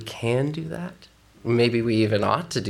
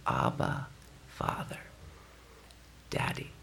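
whispered, tender; reverent whisper; 11 semitones lower, 11 dB quieter, slower than usual. Real, not acted. Pastor reading Romans, reverent whisper. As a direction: breathe the cry softly.

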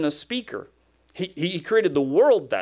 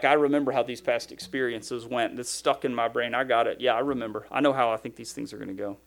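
imitating a listener; 6 semitones lower, 4 dB quieter than usual.

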